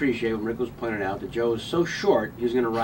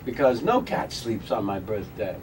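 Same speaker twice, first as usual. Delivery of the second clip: deadpan Walken cadence; Christopher Walken impression; 2 semitones higher, 3 dB louder than usual. Continues Walken bit.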